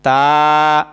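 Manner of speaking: তা held low and clear; model letter. Shown as a demonstration. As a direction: lead repetition of তা. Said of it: "আবার বলেন". (তা স্পষ্ট করে বলা)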